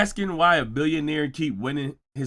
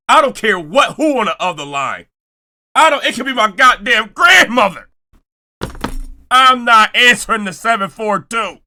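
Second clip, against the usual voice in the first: loud rant; 5 semitones higher, 5 dB louder than usual. Real, not acted. Ranting about ignored calls.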